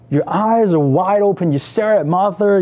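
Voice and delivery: plain reading voice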